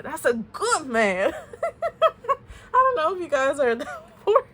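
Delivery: real laughter breaking through the words; cracking up at the message she received; laughing. Real, not acted. A tarot reader tells viewers their partner is a good man, real laughter.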